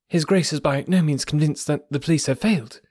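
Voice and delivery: lightly voiced sharp young secretary, firm and positive; incisive, positive tone. A narrator's reading. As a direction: clip each point with polite authority. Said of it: "in an incisive and positive tone".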